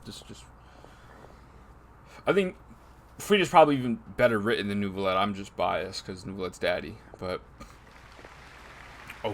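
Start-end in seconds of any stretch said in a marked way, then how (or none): none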